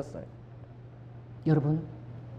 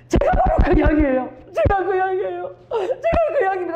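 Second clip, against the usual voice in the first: higher, louder tearful cry; crying confession; 9 semitones higher, 4 dB louder than usual. Retold or imitated. Woman confessing she is the lost sheep, tearful cry. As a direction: sob it out, half laughing. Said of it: "그러면서 막 우시는 거야".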